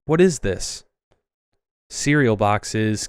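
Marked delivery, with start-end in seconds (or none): 0.1-0.8 high, frantic and breathless; panicked. Acted. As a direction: panic rising in the voice. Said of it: "I panted".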